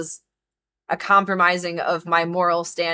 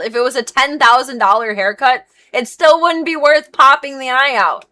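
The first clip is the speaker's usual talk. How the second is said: heated, louder and higher insistence; impassioned; 5 semitones higher, 7 dB louder than usual. Real, not acted. Groomer venting about being asked to harm an animal, impassioned. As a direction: insist with rising outrage.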